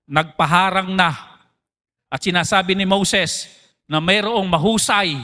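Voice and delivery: ordinary delivery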